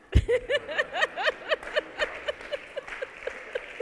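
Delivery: big high burst of laughter; loud laugh. Real, not acted. Big laugh at own story.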